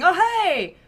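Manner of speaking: high, bright call of hello; cheerful greeting. Acted. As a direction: hail the heroes from down the street. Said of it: "shouting out pleasant greetings".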